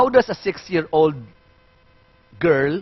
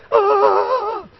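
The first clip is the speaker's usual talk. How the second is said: adult crying wail. Shown as a demonstration. Teacher demonstrating adult crying to the class. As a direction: howl like a heartbroken adult. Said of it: "So the adult says".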